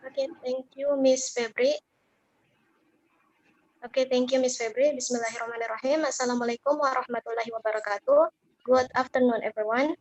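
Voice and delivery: loud, projected presentation voice; student presenter announcing her group to the class, loud and formal. Acted. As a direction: address the whole class like opening a formal talk.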